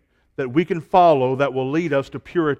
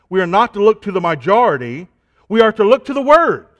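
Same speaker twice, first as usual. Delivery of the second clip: rising, louder pulpit cadence, fast, piling up "not to look"; preaching at full force; 5 semitones higher, 7 dB louder than usual. Acted. Preacher warning the church against the world, preaching at full force. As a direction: build the repetitions like a rally to the climax.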